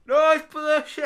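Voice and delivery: yelled high and loud; shouting over machinery. Pretend. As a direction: bellow it over the roar.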